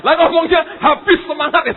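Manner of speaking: high, shouted mimicry; loud impersonation at an excited high pitch; imitating enthusiasm. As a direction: shout it as keenly as he did. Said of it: "saya nirukan".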